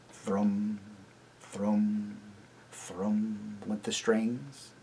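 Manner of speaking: plain reading voice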